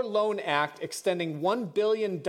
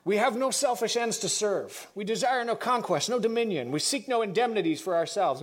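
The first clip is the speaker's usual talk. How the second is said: Woodrow Wilson; 3 semitones higher than usual. Acted. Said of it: "I'm going back to my voice now".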